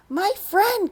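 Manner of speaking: a tiny Who piping up; tiny mayor's voice. Acted. The speck's mayor thanking Horton, high tiny voice. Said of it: "came the voice".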